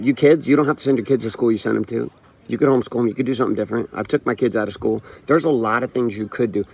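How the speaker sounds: usual speaking voice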